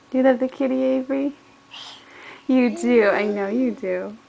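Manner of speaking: usual speaking voice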